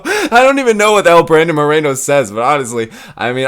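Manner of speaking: usual speaking voice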